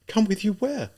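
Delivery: high, small woman's voice; hesitant, meek query; timid question. A narrator's reading. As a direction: ask it timidly, pitched high. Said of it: "Sonia asked timidly".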